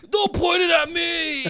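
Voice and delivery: very high, drawn-out mock shout; imitated friend's panicked cry. Friend begs not to be puked on, high mock shriek. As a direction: squeal it slowly, mimicking her. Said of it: "and she's like".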